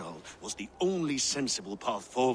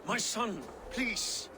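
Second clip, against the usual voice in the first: desperate plea; 10 semitones higher, slower than usual. Acted. Father pleads as son leaves.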